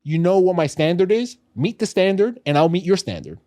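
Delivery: ordinary delivery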